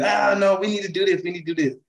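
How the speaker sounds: high-pitched mock adult brush-off; imitating dismissive adults. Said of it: "most adults would just tell you".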